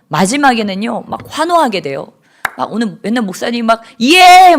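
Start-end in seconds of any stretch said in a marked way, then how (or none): 4.0-4.6 loud, high, drawn-out cheer; big imitated shout of YES; pastor's victory shout. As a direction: imitate a pastor whooping to rouse the crowd. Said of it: "소리 지르자고".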